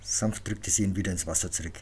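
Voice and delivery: plain reading voice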